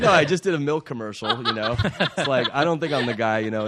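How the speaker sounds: laughing. Real, not acted. Laughing as he recalls doubting he was right for the part. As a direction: chuckle the words out in disbelief. Laughing off the Karate Kid offer.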